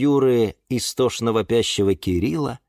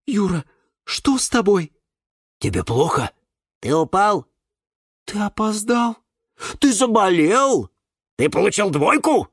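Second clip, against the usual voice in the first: higher, varied voices of pets; a chorus of worried animals; 8 semitones higher than usual. Acted. Pets asking in many voices. (питомцы наперебой тревожно спрашивают)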